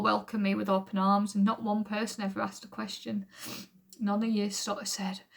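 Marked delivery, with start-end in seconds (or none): none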